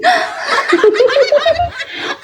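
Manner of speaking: high soft giggling burst; high laugh. Acted. (ऊँची खिलखिलाती हँसी)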